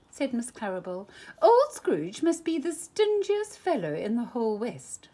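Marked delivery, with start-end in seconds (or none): 1.4-5.1 higher, louder lady's voice; a scandalized townswoman tutting; gossiping townswoman. A narrator's reading. Miss Claribel voiced.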